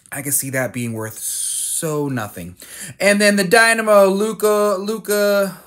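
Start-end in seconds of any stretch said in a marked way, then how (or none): 3.0-5.6 excited. Real, not acted. Excited by a Luka card.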